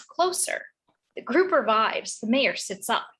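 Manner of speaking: ordinary delivery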